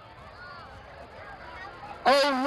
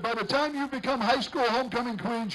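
ordinary talk, nothing marked

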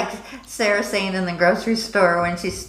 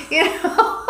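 laughing; 11 semitones higher than usual. Real, not acted. Laughing at own story.

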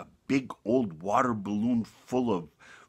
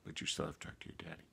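quiet, fast whisper; shepherd softly forgiving the lamb; 5 semitones lower, 9 dB quieter, faster than usual. Acted. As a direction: forgive him gently, barely voiced.

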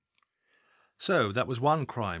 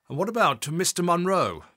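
sharp, louder, higher demand; pressing boss; 7 semitones higher, 7 dB louder than usual. Acted. Assistant chief constable demanding Adam explain tailing Munro, sharp. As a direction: push hard for an answer.

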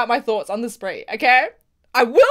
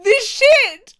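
high, heated rant; real exasperated anger; 9 semitones higher, 4 dB louder than usual. Real, not acted. Fan mad at the show, exasperated. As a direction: vent it high, really mad. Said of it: "I'm so mad".

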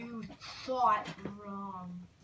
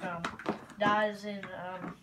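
usual speaking voice

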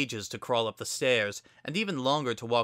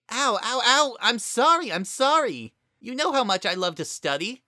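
pained yelps; 12 semitones higher, 5 dB louder than usual. A narrator's reading. Ear being tugged.

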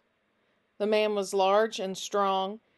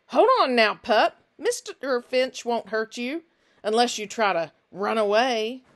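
put-on louder, gripping threat to a captured boy; menacing stranger Finch; 3 semitones higher, 7 dB louder than usual. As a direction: snarl a warning while holding him tight. Finch grabs and threatens Nick.

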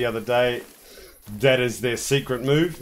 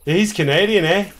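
loud, higher mock Canadian accent; 6 semitones higher, 7 dB louder than usual. Teasing co-player over accents, mock Canadian accent. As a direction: tease with a hammed-up Canadian "eh". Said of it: "You like my Canadian accent".

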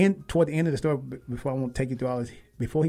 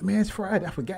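surprised; 8 semitones higher than usual. Real, not acted. Suddenly realizes it's Friday.

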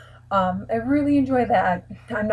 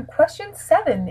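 higher, slow announcement; announcer voice; 8 semitones higher than usual. Acted. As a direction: announce it like a host. Announcing the next question.

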